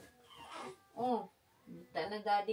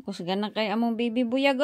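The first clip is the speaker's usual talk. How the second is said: usual speaking voice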